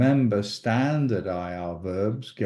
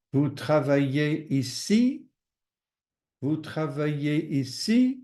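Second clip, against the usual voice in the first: "Vous travaillez ici" higher, rising on "ici"; question intonation; 4 semitones higher than usual. Shown as a demonstration. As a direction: lift "ici" at the end to make it a question. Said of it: "changing the intonation of your voice".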